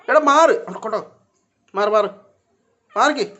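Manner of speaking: repeated loud, high commands; shooing a pet. Real, not acted. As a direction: shout at the cat to shift. Shooing cat blocking the way. (ഉച്ചത്തിൽ മാറാൻ പറയുന്നു)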